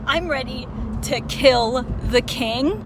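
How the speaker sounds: loud, slow, seething declaration; furious. Real, not acted. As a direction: promise vengeance out loud. Furious at the king.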